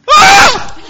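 piercing high loud scream; sudden scream. Retold or imitated. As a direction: scream out of nowhere. Wife screams while he drives.

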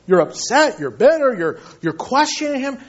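ordinary delivery

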